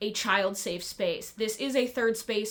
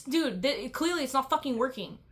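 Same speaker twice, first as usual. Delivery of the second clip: ordinary talk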